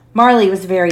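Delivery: ordinary delivery, nothing marked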